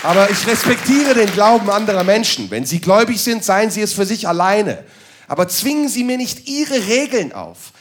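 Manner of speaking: ordinary talk, nothing marked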